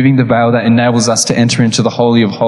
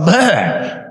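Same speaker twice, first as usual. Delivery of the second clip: higher grossed-out noise, retold; disgusted ugh; 4 semitones higher than usual. Preacher recalling how gross he felt, disgusted "ugh". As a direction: let out a mock shudder of disgust. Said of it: "I felt gross after that".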